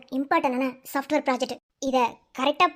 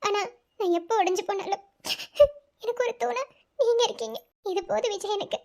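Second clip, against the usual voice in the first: tearful; 5 semitones higher than usual. Acted. Wife confides in husband after crying.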